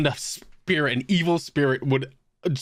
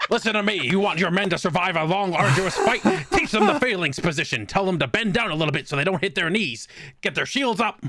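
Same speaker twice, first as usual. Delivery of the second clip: a coach giving a pep talk, raised pitch; gruff sports coach; 6 semitones higher than usual. Acted. Player voicing his coach character lecturing the villain, lightly hammed-up coach. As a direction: lecture them like a hard-nosed coach.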